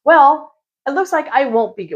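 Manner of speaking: annoyed. Retold or imitated. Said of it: "I'm pissed".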